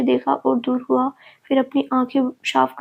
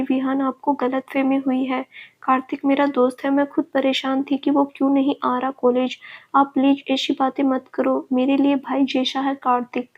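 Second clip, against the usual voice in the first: usual speaking voice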